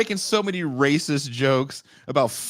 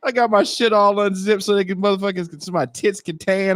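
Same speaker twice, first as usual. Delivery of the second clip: ordinary delivery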